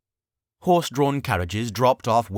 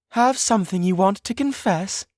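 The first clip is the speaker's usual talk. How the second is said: lilting, teasing sing-song; high playful singsong tease; 13 semitones higher, 5 dB louder than usual. Acted. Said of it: "her sing-song voice said from behind".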